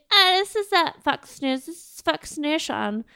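mock commercial pitch; blonde spokeswoman. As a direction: imitate a goofy ad spokeswoman, drawing it out. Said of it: "she was like".

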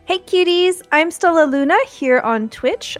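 normal talking voice

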